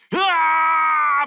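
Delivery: loud high cry of effort; second strike yell. Retold or imitated. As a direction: swing hard and cry out. Acting Moses striking again. (再次击打时大喊)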